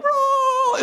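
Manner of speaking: very high squeal of a word; daughter mimicking. Daughter echoing from the back, high squeal. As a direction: imitate a little girl's high-pitched echo. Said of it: "my daughter's like".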